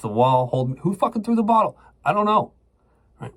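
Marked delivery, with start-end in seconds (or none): 0.8-1.8 much higher, pitched-up retold yell at the wall; the cop losing it, pushing the pitch way up. Cop interrogating him, retold. 2.0-2.5 much higher, strained "I don't know"; scared denial. Retold or imitated. His scared answer, retold.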